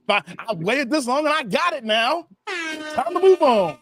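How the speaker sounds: voicing a smug friend, last words slow and drawn out; hammed-up satisfied player; mock smug guy. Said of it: "And he gonna be like".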